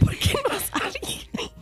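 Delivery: high, slow, laughing through tears; laugh-cry the question, half sobbing; high slow laugh-cry over the video's twist. Retold or imitated. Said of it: "Y yo así".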